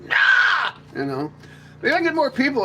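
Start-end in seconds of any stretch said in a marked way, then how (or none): 0.0-0.7 high, loud conspiracy yell; crazy man yelling; mock shout. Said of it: "he's like".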